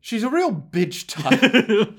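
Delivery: drawn-out mock delivery; mock deadpan quote. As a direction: ham up the quoted line. Quoting the line again.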